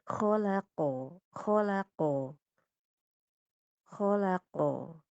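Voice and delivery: "khalaqo" slow, ending only lightly toward o. Shown as a demonstration. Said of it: "enggak terlalu o kayak banget gitu". ("khalaqo" pelan, o tipis di akhir)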